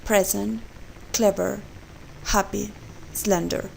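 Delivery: "present", "clever", "happy", "slender", each strong at the start; first-syllable stress. Shown as a demonstration. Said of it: "the stress is on the first syllable".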